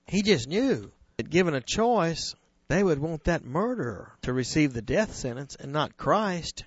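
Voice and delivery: ordinary talk, nothing marked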